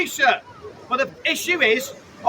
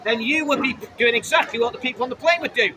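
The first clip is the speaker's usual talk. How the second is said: normal talking voice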